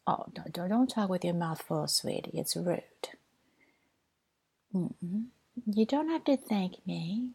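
ordinary talk

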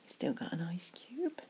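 hushed ASMR whisper, close to the mic; soft whisper. Acted. To a friend at the pub, whispered ASMR comfort. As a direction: breathe the words softly like a secret.

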